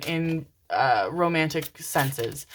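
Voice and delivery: ordinary talk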